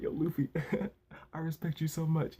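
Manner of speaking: high, soft, choked-up imitation; half-laughing mock teary voice, small and high; mock tearing up. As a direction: lightly pretend to well up with admiration. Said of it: "he starts tearing up".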